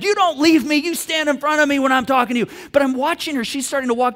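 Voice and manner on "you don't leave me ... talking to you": high, loud angry command; stern dad. Acted. Previous dad to daughter walking out, angry command. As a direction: order them back, indignant. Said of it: "previously, I would have been like".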